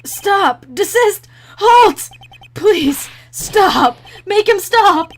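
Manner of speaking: high, loud desperate outburst; panicked shouting. Acted. Cornered witness pleading in court, panicked shouting. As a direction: beg frantically for it to stop, shouting. Witness begs list reading stop.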